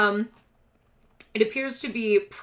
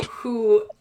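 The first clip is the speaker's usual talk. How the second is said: usual speaking voice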